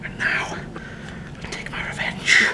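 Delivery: a breathy, mock-sinister whisper vowing revenge; menacing whisper. Owner playfully threatening his dog, mock villain whisper. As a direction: hiss the revenge like a cartoon villain.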